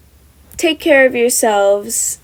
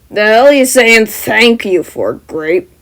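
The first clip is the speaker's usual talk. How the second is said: loud, scornful shout; loud yell; 6 dB louder, slower than usual. Acted. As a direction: shout it with contempt. Bakugo yells at Mineta.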